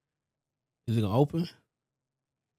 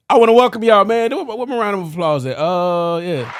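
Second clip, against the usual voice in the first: louder, pumped welcome; hyped show intro; 6 semitones higher, 6 dB louder than usual. Real, not acted. Host welcoming listeners to the episode, loud hype. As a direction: hype up the crowd.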